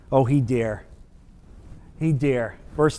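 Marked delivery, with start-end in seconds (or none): none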